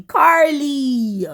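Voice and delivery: a slow, drawn-out chiding call of the name; drawn-out mock scold. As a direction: stretch the name slowly, chiding. Repeats name, different voice.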